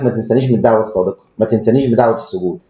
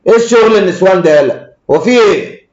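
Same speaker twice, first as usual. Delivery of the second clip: scornful scolding; 6 semitones higher, 4 dB louder than usual. Real, not acted. Scolding scared clients.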